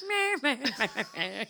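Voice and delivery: laughing meh meh mumble; giggly nonsense mumble; mocking blah blah. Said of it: "me and Tom were like".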